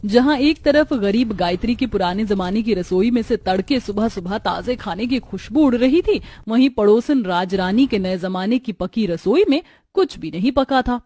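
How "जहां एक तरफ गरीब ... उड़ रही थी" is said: plain reading voice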